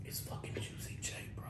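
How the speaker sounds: urgent whisper, no voice at all; retold whisper. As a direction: hiss it so the caller can't hear. Friend hisses who is calling.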